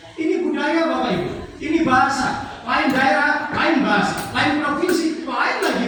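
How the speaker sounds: high, emphatic rhetorical list; preaching. Real, not acted. Preacher telling the congregation cultures differ, high emphatic preaching. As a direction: hammer home each difference to the listeners.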